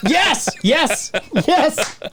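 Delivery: high, eager repeated yes; excited. Real, not acted. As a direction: jump at the offer excitedly. Eager yeses to hearing dad story.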